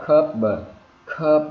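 "curb" with the B barely sounded; unreleased final B. Shown as a demonstration. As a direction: close the lips on "curb" without pushing out the B. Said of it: "không cần phát là rõ".

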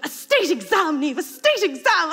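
hiss-scold the daughter; indignant mother; angry mum. Retold or imitated. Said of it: "she's going".

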